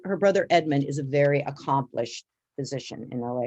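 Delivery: ordinary delivery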